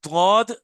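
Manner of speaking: तौद said with a heavy, full Arabic d; demo letter sound. Shown as a demonstration. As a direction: show the heavy letter clearly. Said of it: "आप खुद देखिए".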